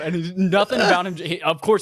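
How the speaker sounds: ordinary talk, nothing marked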